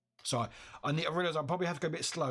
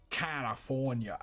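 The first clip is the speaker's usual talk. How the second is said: slow, stretched-out American California; exaggerated American accent; slower than usual. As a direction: draw out each syllable in a mock American accent. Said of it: "I can't even think about American".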